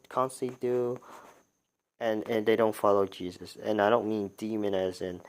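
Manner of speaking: ordinary talk, nothing marked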